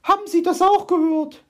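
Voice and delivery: very high, loud startled ask; frightened question. Acted. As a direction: ask in alarm. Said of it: "Fragte sie ihn erschrocken".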